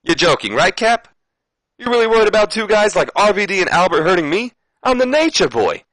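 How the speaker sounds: over-the-top Flair disbelief; Ric Flair voice. Acted. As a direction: scoff like an insulted legend. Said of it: "And Rick goes".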